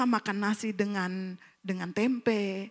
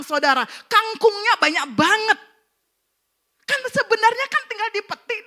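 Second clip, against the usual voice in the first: high, loud, emphatic sermon delivery; impassioned preacher at high pitch; full-force preaching; 10 semitones higher, 8 dB louder than usual. Real, not acted. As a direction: drive each point home to the congregation.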